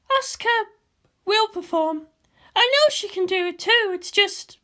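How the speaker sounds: a tense commander on the eve of battle; Misato's voice. Acted.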